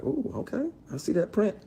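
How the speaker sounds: high breathy-free falsetto mimic of the actress; mocking her flirty coo. Imitating the actress teasing him on set, high mock coo.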